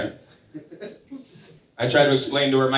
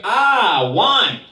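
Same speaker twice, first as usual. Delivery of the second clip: put-on Cuban grandma accent; 9 semitones higher, 6 dB louder than usual. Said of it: "She was like".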